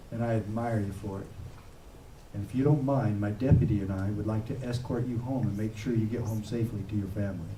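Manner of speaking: quiet, respectful comfort; gentle respect. Retold or imitated. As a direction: speak kindly, as if honoring her. Tender words to Rosa Parks.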